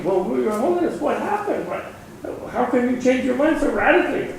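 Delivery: high-pitched, baffled questioning; astonished questioning. Acted. Secretary asks the Pope about his sudden reversal, astonished.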